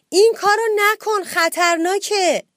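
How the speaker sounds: high, loud cry of warning; warning shout. A narrator's reading. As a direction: shout to stop a friend from danger. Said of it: "کامیل فریاد زد".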